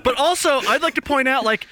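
fired-up, laughing indignation; worked-up rant. Real, not acted. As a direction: rant at him in mock outrage. Ranting about the commentator.

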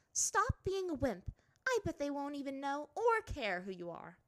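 high, sharp princess voice; a proud girl brushing it off; haughty princess. A narrator's reading. Azula scolds Ty Lee.

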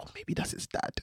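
imitating a kid's hushed whisper; very soft, voiceless retold whisper. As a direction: whisper it like a secret aside to a mate. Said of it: "I heard one whisper to go".

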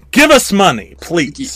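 high, loud jokey plea; mock begging. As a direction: beg the listeners for cash, hammed-up.

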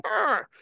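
high frustrated arr; mock angry growl. Guy reacting to being reported, mock angry growl. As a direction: snarl it like a pirate.

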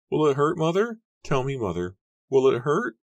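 put-on high girl's voice, rising questions; frightened pleading. A narrator's reading. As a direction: beg mother for an answer. Frightened daughter pleading at the stake.

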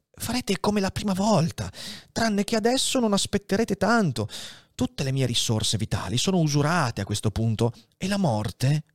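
lightly voiced dying old priest, weary; a hint of an old man shivering with fever; dying old abbé. Dying Faria tells Edmond his attack is coming, weary.

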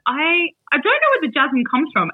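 baffled retort. Retold or imitated. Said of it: "I'm like".

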